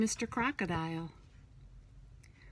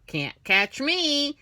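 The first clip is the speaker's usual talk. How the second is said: higher, slow, louder taunt; teasing taunt; 6 semitones higher, 7 dB louder, much slower than usual. Acted. Monkey taunting the crocodile from the tree, slow teasing. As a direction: dare him, cheeky and slow. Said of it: "Tease Mister Crocodile".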